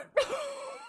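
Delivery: high, breathless laugh close to tears; crying laughter. Real, not acted. Cracking up on the podcast until she is in tears. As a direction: let the laugh tip into teary squeaks. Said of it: "I'm literally crying".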